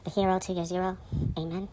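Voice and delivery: soft, half-whispered date and playlist; hushed intro. Real, not acted. Hushed early-morning recording intro, half-whispered. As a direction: murmur it so nobody wakes.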